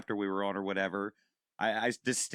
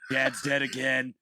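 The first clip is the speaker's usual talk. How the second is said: gruff mock Dean; 3 semitones higher, 4 dB louder, slower than usual. Continuing Dean Winchester bit.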